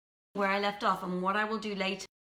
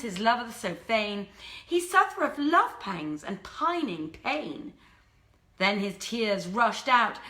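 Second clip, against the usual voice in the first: lively storyteller voice, lifted and expressive; story narration; 4 semitones higher, 3 dB louder, slower than usual. A narrator's reading. Storyteller reading Arabian Nights to a live audience, lively and expressive. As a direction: read the old tale with relish to the audience.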